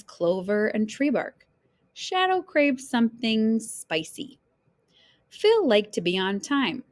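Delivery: usual speaking voice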